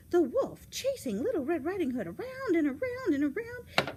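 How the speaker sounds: high, loud, climbing repetition; excited chase narration. A narrator's reading. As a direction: spin the words round, rising with the chase.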